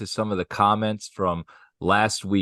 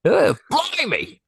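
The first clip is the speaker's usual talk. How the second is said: high, drawn-out mock British accent; put-on British accent; 13 semitones higher, slower than usual. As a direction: ham up a slow British accent. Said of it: "I do really good accents".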